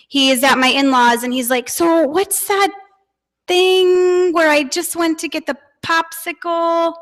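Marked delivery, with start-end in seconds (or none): 1.7-6.9 lightly voiced child, higher; a hint of a little boy asking; kid voice. Kid voiced searching for a word.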